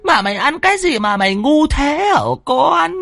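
anguished scolding on stretched syllables; tearful lament. Acted. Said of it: "Bà rơm rớm nước mắt".

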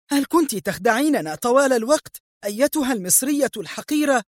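high-pitched furious accusation, no added volume; enraged outburst. A narrator's reading. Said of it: "وهي تستطرد في ثورة".

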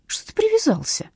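high-pitched irritated retort; annoyed. A narrator's reading. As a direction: snap back with pitch jumping up. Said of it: "разозлилась Ирина".

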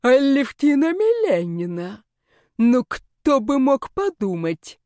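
drawling mock surprise. A narrator's reading. Moustached official catches the heroine red-handed, drawling mock surprise. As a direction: stretch the words out with mock astonishment. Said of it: "протянул рыжий усатый жучара".

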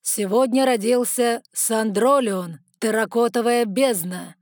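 slow, booming royal cry; loud piercing proclamation. Acted. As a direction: proclaim it to the whole hall. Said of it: "голос короля сделался громким и пронзительным".

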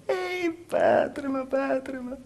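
high pleading cry that fades; calling out, fading. Acted. Daughter crying out to her father, high then fading. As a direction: call out to father, then lose strength.

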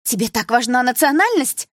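high, loud indignant question; challenging retort. A narrator's reading. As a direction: throw the question back sharply. Daughter challenges angry father.

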